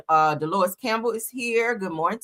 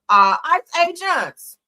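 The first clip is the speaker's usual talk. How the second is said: called out; 7 semitones higher, 6 dB louder, much slower than usual. Real, not acted. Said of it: "calling out to ICE agents".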